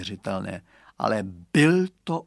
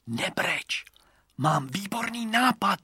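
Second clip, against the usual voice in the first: hushed conspiratorial whisper; 6 semitones higher than usual. A narrator's reading. Boy whispering his idea to the crying girl, hushed. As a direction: whisper a secret plan comfortingly. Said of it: "zašeptal jí Radovan".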